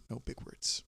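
whispered "no big words" to himself. Retold or imitated. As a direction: breathe the words with no voice. Telling himself in his head.

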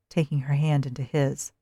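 plain reading voice

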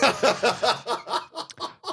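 long, high fake cackle; forced mock laugh. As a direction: laugh sarcastically at a dragged-out gag. Mocking comedy that lasts so long.

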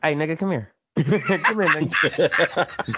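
playful menace, breaking into laughter; half-laughing tough-guy call; mock threat, laughing. Acted threat to the imitator.

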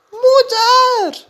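put-on high, loud child's cry for mother; calling out. A narrator's reading. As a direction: call out for mother in the dark. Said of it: "riep Jip". (Jip roept 's nachts luid en hoog om moeder)

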